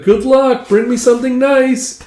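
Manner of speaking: high-pitched far-carrying farewell; calling goodbye. Acted. Villager waving the rowers goodbye, calling out. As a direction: call after a departing ship.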